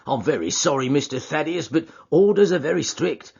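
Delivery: suspicious doorkeeper voice; guard the door, wary and blunt; gruff porter voice. Acted.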